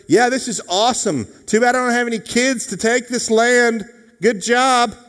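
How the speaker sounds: loud, high, hammed-up sarcastic cheer; mock enthusiasm. Abraham surveying the promised land without children, mock enthusiasm. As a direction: play up a sarcastic delight at full pitch.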